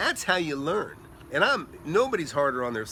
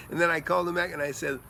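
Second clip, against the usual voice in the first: usual speaking voice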